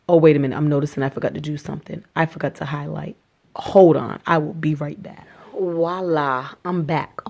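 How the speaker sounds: ordinary delivery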